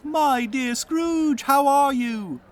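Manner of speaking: high, loud mock-cheerful voice; a falsetto of fake friendliness; gladsome greeting. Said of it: "to say with gladsome looks".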